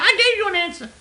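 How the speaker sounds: high, loud caricature retort; mocked curt manager. Manager retorting to the caller, high loud mockery. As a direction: insist in an exaggerated high voice. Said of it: "She said".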